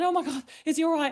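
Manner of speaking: high, quick anxious question; retold worry. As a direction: re-enact an urgent check on the dog. Retells her worried phone reply.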